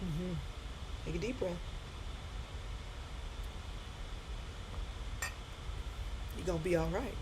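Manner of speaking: hushed, low, long pauses between phrases; soothing. Real, not acted. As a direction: gently guide them through a deep breath. Calming crying listeners, guiding breaths.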